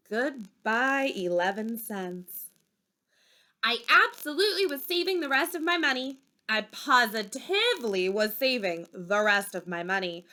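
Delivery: ordinary delivery, nothing marked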